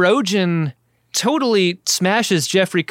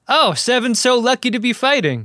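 gushing, higher-pitched mock fan voice; excited superfan; 8 semitones higher than usual. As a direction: gush like a clueless, thrilled fan. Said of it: "He is really excited".

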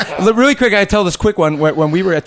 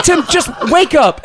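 coaxing laugh; 12 semitones higher, slower than usual. Retold or imitated. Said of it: "I go".

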